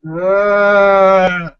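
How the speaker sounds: zombie groan. Retold or imitated. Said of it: "the zombie noise".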